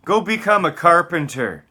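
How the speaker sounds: loud, high sneering taunt; scornful yelling lecture; mocking shout. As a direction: jeer at him at full volume. Mocking rant at an imagined listener.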